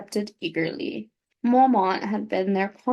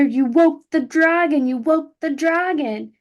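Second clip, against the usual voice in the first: higher, louder screamed accusation; screaming; 6 semitones higher, 6 dB louder than usual. Acted. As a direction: scream it at her as you kick. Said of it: "he screamed".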